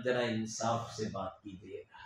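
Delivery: soft request. Retold or imitated. Child asking uncle softly.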